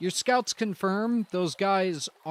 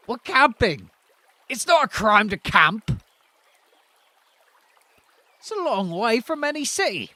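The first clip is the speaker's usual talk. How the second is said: higher, louder camper protesting; indignant camper; 6 semitones higher, 8 dB louder than usual. Acted. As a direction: protest loudly that camping is no crime. Camper NPC protests loudly.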